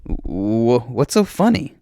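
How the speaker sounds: high, unsure question; insecure. A narrator's reading. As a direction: ask it nervously, high. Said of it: "he sounds so insecure".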